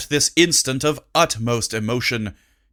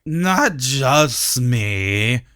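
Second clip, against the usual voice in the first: put-on drunk slur; much slower than usual. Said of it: "tipping a bit".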